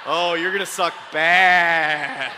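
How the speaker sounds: BAD dragged out long and loud; slow build into a long, loud BAD; stress on bad. Real, not acted. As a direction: stretch the word bad way out for emphasis. Repeats the tease, stretching it out.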